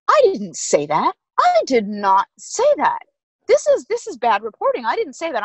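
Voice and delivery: indignant denial. Retold or imitated. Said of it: "I'm getting all het up".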